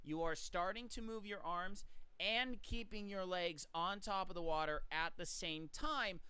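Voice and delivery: ordinary delivery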